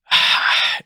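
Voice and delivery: heavy breathy sigh; exasperated sigh. Retold or imitated. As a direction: sigh as if facing a hassle. Retelling his weary thought before turning.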